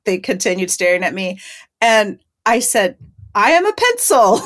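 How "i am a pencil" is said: high, loud, breaking into laughter; laughing explanation. Retold or imitated. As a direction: spell out the failed joke through a laugh. Retold explaining failed joke, laughing.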